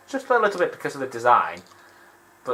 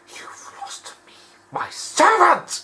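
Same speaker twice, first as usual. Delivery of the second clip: Lucius Malfoy menacing; much slower than usual. Retold or imitated. Malfoy turning on Harry, in a mock menacing wizard voice. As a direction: threaten like a cold aristocratic wizard. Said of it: "he's like".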